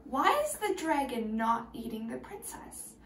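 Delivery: normal talking voice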